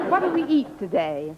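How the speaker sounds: ordinary delivery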